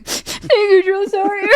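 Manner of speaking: high, squeaky imitation of crying; mock tearful thanks. Trainee praised by her old drill sergeant, mock-tearful. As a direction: squeak out thanks through mock sobs and laughter.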